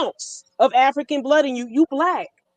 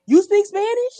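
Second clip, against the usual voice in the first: mock surprise; 8 semitones higher than usual. Clerk realizes she speaks Spanish.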